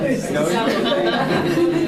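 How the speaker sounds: a real, loud laugh; amused laughter breaking out; loud burst of laughter. Real, not acted. Host laughs at the reply.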